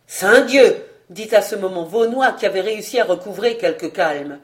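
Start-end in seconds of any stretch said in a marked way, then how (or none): 0.1-0.8 loud, indignant burst; exclaimed oath. A narrator's reading.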